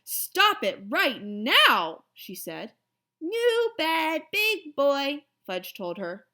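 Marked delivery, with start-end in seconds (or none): 0.0-2.0 slow, loud and stern, each word spaced out; a mother snapping at jumping kids; louder stern order; angry. Acted. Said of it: "she was mad". 3.2-5.2 put-on high, louder little-kid boast; a toddler bragging about his bed; proud toddler voice, higher; proud little boy. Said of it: "Was he proud".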